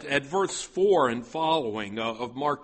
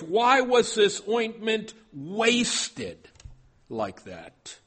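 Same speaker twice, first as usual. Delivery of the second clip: higher, slow indignant question, stretched out; indignant retort; 6 semitones higher, 3 dB louder, slower than usual. Retold or imitated. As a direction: mimic the scolders' resentful complaint. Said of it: "you can hear the anger in their voice".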